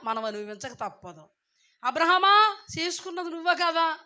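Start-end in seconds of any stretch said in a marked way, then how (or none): 1.8-4.0 God rebuking Abraham. Acted.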